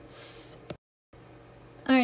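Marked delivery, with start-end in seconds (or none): 0.1-0.6 hissing snake s; long unvoiced hiss like a snake; drawn-out s like a snake. Shown as a demonstration. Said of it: "like the snake".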